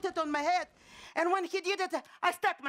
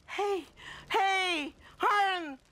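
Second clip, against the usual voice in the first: calling out; 4 semitones higher, much slower than usual. Acted. Calls to son from hanging.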